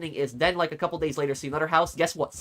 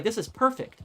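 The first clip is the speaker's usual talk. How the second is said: excited delight; 9 semitones higher than usual. Retold or imitated. Excited about the nearby house.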